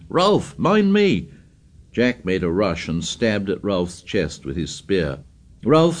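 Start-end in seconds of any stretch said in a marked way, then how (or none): 0.1-1.3 high scared scream. Acted. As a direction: scream for help. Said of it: "Piggy screamed".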